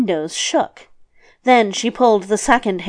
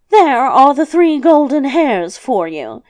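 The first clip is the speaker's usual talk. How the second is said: higher, louder, emphatic delivery; lightly voiced grandmother; 4 semitones higher, 5 dB louder than usual. Old woman handing hairs to the luck child, lightly voiced. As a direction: present it in a raised character voice.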